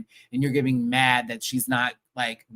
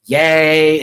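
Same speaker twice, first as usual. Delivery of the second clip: mock yay; 11 dB louder than usual. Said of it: "because she's like".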